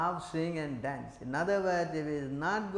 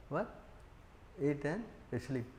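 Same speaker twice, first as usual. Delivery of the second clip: normal talking voice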